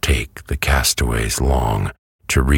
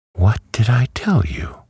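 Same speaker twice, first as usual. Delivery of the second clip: high-pitched hen gloating; triumphant cackle; 10 semitones higher than usual. A narrator's reading. Hen is proved right about metal, triumphant. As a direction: crow it with rising triumph. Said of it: "cried the hen, with a cackle of triumph".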